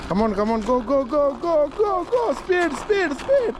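excited cheering. Real, not acted. Cheering child driving toy car. (ആവേശത്തോടെ പ്രോത്സാഹനം)

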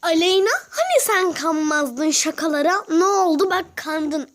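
loud, gloating tease; teasing taunt. Real, not acted. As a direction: rub it in playfully. Teasing friend who fell for prank. (alaycı, yüksek sesle takılma)

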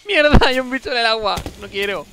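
high, loud frightened cry; panicked shout. Real, not acted. Streamer panicking at a creature in the water, loud high cry. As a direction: blurt it out in real panic. Panicked outburst in horror game.

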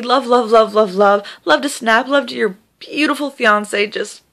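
normal talking voice

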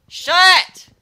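a single explosive screamed word, very high and very loud; sudden scream. Real, not acted. Screaming at the barking dogs to shut up, full-force scream. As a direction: scream it at the top of your lungs.

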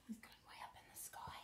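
whispered aside. Real, not acted. Grandma pointing at the picture for her granddaughter, whispering.

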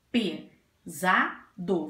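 "Pesado" very slow, "Pe... za... do"; slow, by syllables. Shown as a demonstration. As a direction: repeat "Pesado" in three spaced beats.

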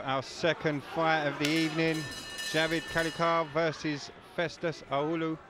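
normal talking voice

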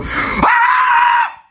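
sudden shrill scream of terror; high scream. Acted. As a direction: shriek as you're snatched up. Grabbed by the creeper.

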